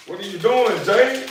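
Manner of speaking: high-pitched call to a pet; high call to dog. Real, not acted. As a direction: sing out to the dog in surprise. Calling out to dog.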